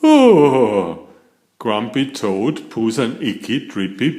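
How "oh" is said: loud, startled gasp of a word; sudden surprised cry. A narrator's reading. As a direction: caught off guard, blurt it out. Toad finds blob in backpack.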